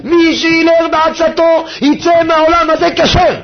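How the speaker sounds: slow, loud, high proclamation; a solemn proclaimed quote; quoting the Rebbe. Retold or imitated. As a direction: declare the master's promise. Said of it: "רבנו מפתיע".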